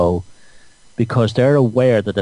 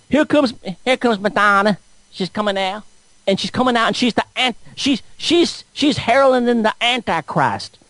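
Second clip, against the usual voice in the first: high, excitable parody of a viewer; mock conspiracy believer; 9 semitones higher than usual. Viewer spotting [name] at the halftime show, mocking high voice. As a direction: imitate a worked-up believer, pitched high. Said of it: "and you go".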